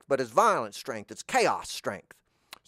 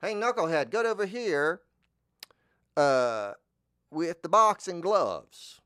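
lightly voiced gruff tough guy yelling; Moe; 4 semitones higher, 4 dB louder than usual. A hint of Moe barking at Curly. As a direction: bark orders as a bossy stooge. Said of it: "That's my Moe impression".

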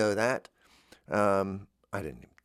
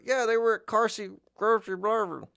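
higher put-on voice; silly put-on voice; 11 semitones higher than usual. Comic answering himself in a goofy higher voice. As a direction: toss it off in a goofy voice.

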